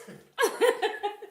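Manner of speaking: loud, high laugh; real laughter. Real, not acted. Amused at husband question.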